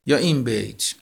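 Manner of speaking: usual speaking voice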